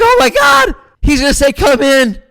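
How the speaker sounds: high, loud burst of hopeful excitement; excited shout. Retold or imitated. Fan at [name]'s gate, thinking he'll be let in, high loud excitement. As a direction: yelp it out like a starstruck fan.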